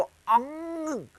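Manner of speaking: अंग with a nasal ng ending, drawn out slowly; nasal ang. Shown as a demonstration. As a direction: say अंग through the nose, slowly. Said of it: "इसको बोलेंगे तो". (नाक से खिंचा हुआ अंग)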